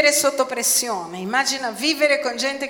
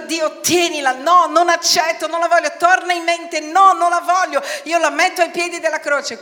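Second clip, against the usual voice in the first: high, raised, urgent refusals; forceful casting-off; 6 semitones higher, 4 dB louder than usual. Shown as a demonstration. Preacher demonstrates throwing worry at the cross, forceful. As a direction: push the worry away with shouted insistence. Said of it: "Questo vuol dire gettare".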